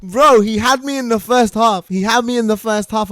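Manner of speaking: high, loud, worked-up disbelief; amazed exclamation. Real, not acted. Streamer admitting he was fooled, amazed exclamation.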